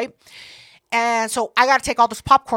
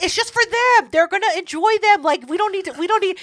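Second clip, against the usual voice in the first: much higher, louder flustered protest; high embarrassed pleading; 8 semitones higher, 4 dB louder than usual. Retold or imitated. Asking the bird not to show her gifts, high flustered plea. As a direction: squeak it out, wanting it to stop. Embarrassed about onesies being shown.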